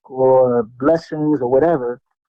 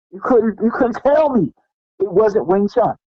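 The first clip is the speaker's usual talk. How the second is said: normal talking voice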